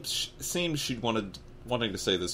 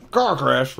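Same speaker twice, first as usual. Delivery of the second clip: loud outraged outburst; roaring; 6 semitones higher, 10 dB louder than usual. Acted. Jumping up angrily at the lie, roaring. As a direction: roar it in indignant disbelief. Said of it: "roared Harry".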